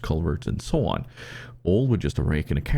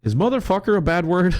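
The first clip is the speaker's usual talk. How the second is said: put-on much higher, louder innocent child asking; little girl voice; 12 semitones higher, 4 dB louder than usual. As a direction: squeak it out like a small daughter. Daughter voiced, high child voice.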